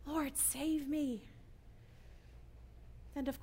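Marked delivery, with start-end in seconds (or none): 0.0-1.2 pleading cry. Retold or imitated. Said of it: "We know that cry".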